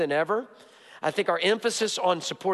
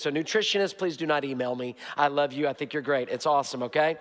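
usual speaking voice

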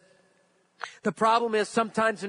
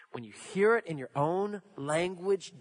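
ordinary talk

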